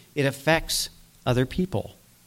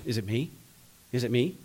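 softer, lower worried murmur; anxious disciples; 3 semitones lower, 9 dB quieter, faster than usual. Retold or imitated. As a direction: ask it under your breath, afraid it's you. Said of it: "and they're going".

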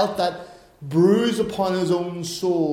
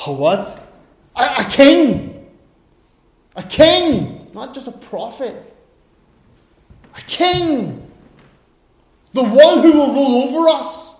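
louder, higher, mocking disbelief; incredulous Pilate; 6 semitones higher, 7 dB louder than usual. Pilate scoffing at the accusers' claim, mock-incredulous. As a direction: scoff at the idea of a king. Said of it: "you can probably imagine Pilate going".

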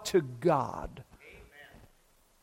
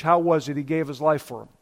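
ordinary delivery

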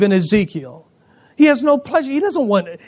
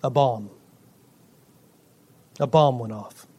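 low dramatic hush; 7 semitones lower, 6 dB quieter than usual. Real, not acted. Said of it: "what I call this".

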